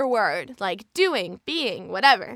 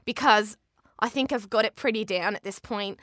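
chatty, easy Australian accent. Shown as a demonstration. Actor telling of fooling people at Disneyland, Australian accent. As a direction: talk casually in broad Aussie. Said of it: "we got a request for Aussie".

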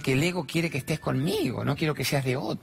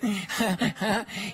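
high laugh; 7 semitones higher than usual. Real, not acted. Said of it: "se reían de los nervios".

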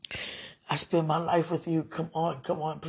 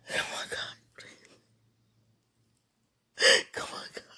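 whispering; 6 dB quieter than usual. Real, not acted.

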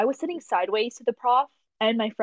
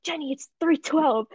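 normal talking voice

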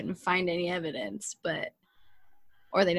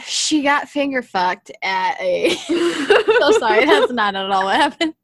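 louder, laughing blurt; 3 semitones higher, 8 dB louder than usual. Real, not acted. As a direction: blurt it out and crack up. Blurting crude story, laughing.

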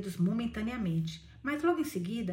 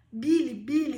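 raised, louder cry full of emotion; emotional; 4 semitones higher, 8 dB louder, slower than usual. A narrator's reading. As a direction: call the name out, moved. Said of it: "com a voz trêmula de emoção".

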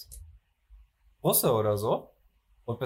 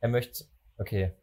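ordinary talk, nothing marked

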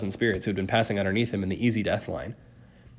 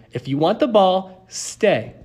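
raised, emphatic dog-trainer voice; ordering; 9 semitones higher, 5 dB louder, slower than usual. A narrator's reading. Girl holding the ball over Cerberus, ordering. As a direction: bargain firmly, landing on the command. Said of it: "Annabeth ordered the monster".

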